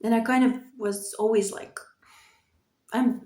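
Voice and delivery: ordinary talk, nothing marked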